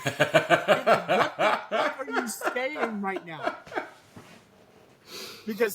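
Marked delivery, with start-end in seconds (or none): 0.1-3.5 incredulous outburst through laughter; drawn out, cracking up in disbelief; laughing incredulity. Real, not acted. Incredulous rant, laughing at the character.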